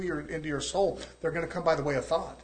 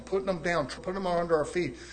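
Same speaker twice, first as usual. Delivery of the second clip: ordinary delivery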